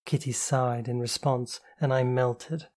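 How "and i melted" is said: ordinary delivery, nothing marked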